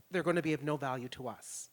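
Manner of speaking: ordinary talk, nothing marked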